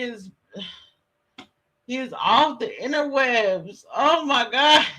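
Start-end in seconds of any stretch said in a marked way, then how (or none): none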